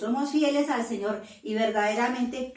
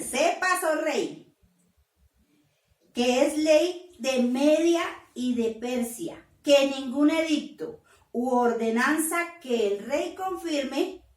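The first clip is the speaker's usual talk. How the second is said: loud proclaiming; 3 semitones higher, 6 dB louder than usual. Acted. Preacher voicing the king's advisers.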